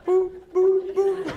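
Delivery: high, loud robot boop noises; silly beeps. Acted. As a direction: beep like a calculator. Mock calculator beeps, loud and high.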